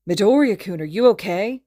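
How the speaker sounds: anxious friend checking on him; worried. A narrator's reading. Friend asks shaken Izuku if he's okay, worried. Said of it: "he asks worriedly".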